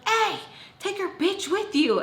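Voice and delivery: high-pitched imitation of yelling out a door; retold call-out. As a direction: call after someone, acting it out. Friend calls out from slammed door.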